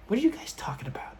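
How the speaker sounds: high, hushed, quick whisper; whispered question. Retold or imitated. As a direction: imitate a grandmother chiming in under her breath. Imitates puzzled grandmother chiming in.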